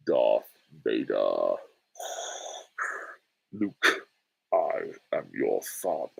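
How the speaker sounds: very low, slow villain voice; Darth Vader. Acted. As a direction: intone it deep and slow, with heavy pauses. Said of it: "Favorite James Earl Jones performance".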